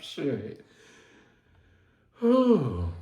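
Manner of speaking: ordinary talk, nothing marked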